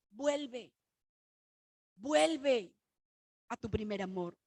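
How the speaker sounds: tender plea. Acted. Preacher quoting God's call.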